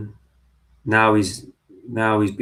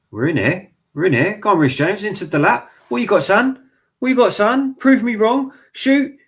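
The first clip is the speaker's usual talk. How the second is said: building from eager to a much higher, louder yell; rising excitement; 11 semitones higher, 7 dB louder than usual. Real, not acted. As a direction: urge the striker on, climbing to a shout. Urging attack as chance builds.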